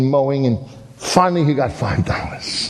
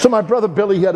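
laughing; 7 semitones higher, faster than usual. Real, not acted. Laughing as he restarts story.

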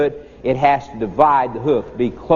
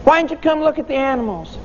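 put-on high, quick children's question; puzzled kids asking; 10 semitones higher than usual. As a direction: ask like baffled schoolkids. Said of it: "and we said to him".

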